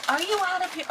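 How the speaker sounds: can't believe it; disbelief. Real, not acted. Disbelief at gift.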